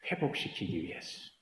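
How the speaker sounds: breathy whispered answer. Acted. Pastor hushing the congregation, whispered. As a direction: drop to a hushed whisper on 회복시키기.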